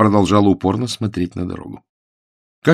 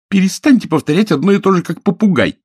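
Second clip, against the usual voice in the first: высоко и громко, с досадой; оборвать его криком; раздражённый крик; 12 semitones higher, 6 dB louder than usual. Acted. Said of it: "закричала на него Билли". (девушка кричит на надоедливого жениха, громко и высоко)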